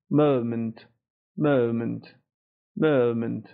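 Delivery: MOMENT with British "əu" in the first syllable; British pronunciation. Shown as a demonstration. Teacher showing learners British مومنت, central "əu" vowel. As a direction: say مومنت starting from a central "uh" glide.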